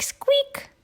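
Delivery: very high whispered squeak. Acted. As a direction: breathe a tiny mouse squeak. Mouse imitates squeak.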